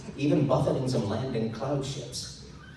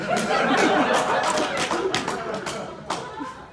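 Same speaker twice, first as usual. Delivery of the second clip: airy rising laugh; breathy laugh; 5 dB louder than usual. Real, not acted. Narrator giggling at the lord's snub.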